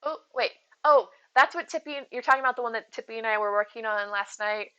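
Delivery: ordinary talk, nothing marked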